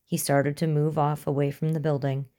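plain reading voice

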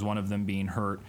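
ordinary delivery

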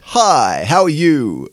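loud, high mock presenter greeting; exaggerated radio host hello. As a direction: greet the audience with hammed-up volume. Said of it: "that's your radio voice".

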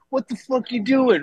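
high mock scold; scolding uncle. Uncle scolds the nephew while grabbing his hair, high mock scold. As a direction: grab him by the hair and scold. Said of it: "and goes".

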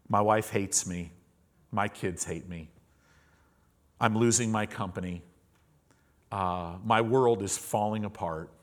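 low, subdued retelling of despair; heavy confession. Retold or imitated. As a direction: confess your troubles quietly, one by one. Retold man confesses his troubles.

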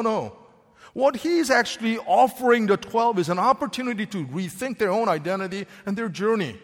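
normal talking voice

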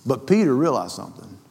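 ordinary delivery

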